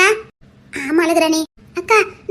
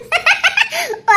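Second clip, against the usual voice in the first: high, rippling laugh; giggling; 9 semitones higher than usual. Acted. Laughs at being scolded.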